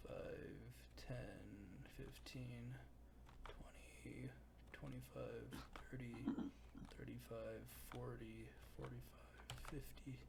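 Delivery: very soft, half-whispered count; counting under the breath. Real, not acted. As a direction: count quietly to yourself. Game master counting out movement distance.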